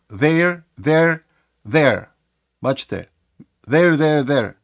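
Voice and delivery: "there" said over and over with shifting pitch; rapid repeated there. Shown as a demonstration. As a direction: fire off "there" quickly several times. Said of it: "коли вимовляються швиденько". (швидке повторення "there")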